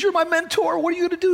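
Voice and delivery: high-pitched, pleading retelling; retold crying. Preacher voicing the friend begging his mentor, tearful. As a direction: imitate a desperate plea through tears. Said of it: "he's crying pretty hard".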